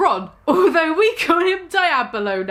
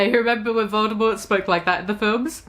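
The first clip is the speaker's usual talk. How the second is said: ordinary talk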